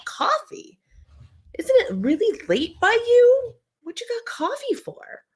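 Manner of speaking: high squeaky disbelief at coffee so late; teasing gasp of disbelief; mock surprise. Real, not acted. Host teasing a viewer over late-night coffee, mock shocked.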